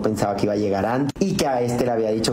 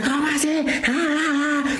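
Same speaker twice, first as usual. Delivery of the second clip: much higher shouted outburst; retold yell; slower than usual. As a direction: cry it out high like a furious husband. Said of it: "El señor empezó a gritar". (grito agudo de marido furioso)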